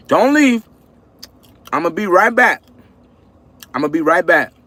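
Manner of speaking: high-pitched plea to hang on; calling out. Real, not acted. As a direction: call out high to keep them there. Calling to viewers before stepping away.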